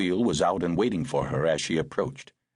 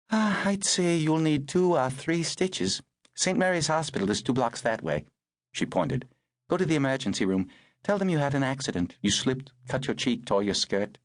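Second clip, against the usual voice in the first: higher, crisp voice for the woman; brisk older woman; 7 semitones higher than usual. Acted. Woman tending the girl in the rain, brisk and higher. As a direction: speak as the no-nonsense rescuer.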